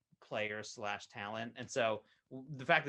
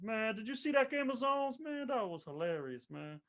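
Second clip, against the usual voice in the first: high, drawn impression voice; Charles Barkley; 11 semitones higher than usual. Retelling a story in the player's own voice, high put-on impression. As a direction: put on the voice, pitched way up. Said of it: "do it in my Charles Barkley voice".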